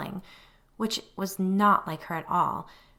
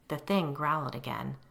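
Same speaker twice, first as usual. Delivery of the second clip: usual speaking voice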